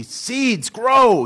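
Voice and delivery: high, loud impatient yell; yelling at seeds. Retold or imitated. Toad yelling at his seeds to grow, high loud impatient shout. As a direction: bark the command at the seeds as if they'll obey. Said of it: "He yells at the seeds".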